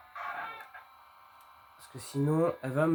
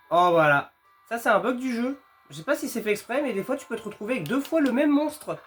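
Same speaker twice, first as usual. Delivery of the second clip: panicking gamer; 8 semitones higher, 5 dB louder than usual. Real, not acted. Double monster chase in game.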